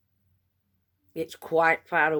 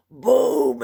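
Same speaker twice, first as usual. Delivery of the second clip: loud imitated boom; 6 semitones higher, 7 dB louder than usual. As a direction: punch out a loud mock boom. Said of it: "let a booming off".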